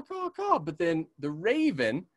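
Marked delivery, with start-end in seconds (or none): 0.0-0.6 high crow caw. Shown as a demonstration. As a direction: imitate the crow's caw. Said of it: "the crow sounds like it's saying caw".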